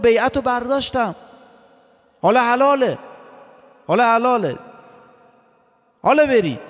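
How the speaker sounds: high-pitched dramatic dismissal; Imam Hussein's address. Acted. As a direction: proclaim it high to the assembled companions. Acting Imam Hussein dismissing followers.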